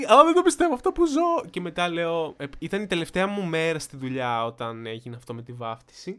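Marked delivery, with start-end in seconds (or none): 0.0-1.5 high, loud cry of disbelief; exclaimed disbelief. Retold or imitated. Waiter reacting to the baptism disaster, loud exclaimed disbelief. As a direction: burst out as if you can't believe it. Acted disbelief at closed restaurant.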